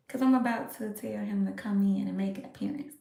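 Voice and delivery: hushed plotting whisper; conspiratorial whisper. Real, not acted. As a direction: whisper it to the viewers. Whispering a secret plan.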